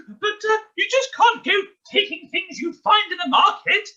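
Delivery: usual speaking voice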